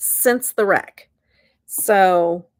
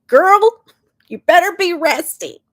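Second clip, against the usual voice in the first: imitating dad, much higher and louder; mock scolding yell; 8 semitones higher, 9 dB louder than usual. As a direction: bark it like a worried dad. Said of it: "he yells at me".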